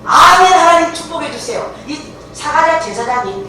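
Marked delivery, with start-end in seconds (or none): 0.0-1.7 high, loud burst of acceptance; eager amen. Acted. Believer welcoming God's word, loudly and eagerly. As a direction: cry it out as a fervent plea.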